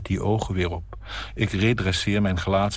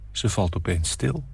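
plain reading voice

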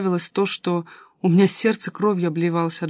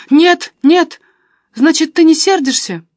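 high, loud relieved rush; eager excitement; 9 semitones higher, 9 dB louder, slower than usual. A narrator's reading. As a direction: burst out eagerly, higher.

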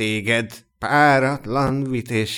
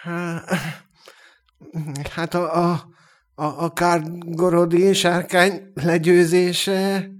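higher, halting voice that catches; faltering voice; 5 semitones higher than usual. A narrator's reading. Elf asks about defeating the dragon, faltering voice. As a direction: stammer it out as the voice fails. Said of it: "kérdezte Gerlin elcsukló hangon".

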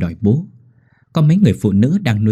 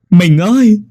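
much higher, louder anguished wail; wailing cry; 11 semitones higher, 8 dB louder, slower than usual. Acted. Husband calling his dead wife, anguished wail. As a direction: cry her name out in agony. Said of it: "rồi khóc".

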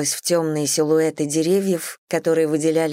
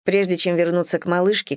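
plain reading voice

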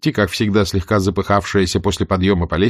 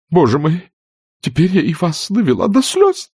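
high, tearful fussy aunt; a touch of a sob in it; mild sobbing aunt; 9 semitones higher than usual. Acted. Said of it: "всхлипнула тётушка Питтипэт". (слегка всхлипывая)

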